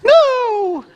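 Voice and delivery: high, whiny falsetto wife refusing; wife's high protest. Retold or imitated. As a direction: squeak out her refusals in a high mock voice. Wife voiced protesting in story.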